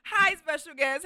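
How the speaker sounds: bright, drawn-out hello to a guest; excited greeting. Real, not acted. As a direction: welcome them with real excitement.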